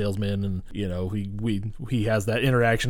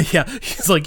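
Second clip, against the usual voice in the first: ordinary talk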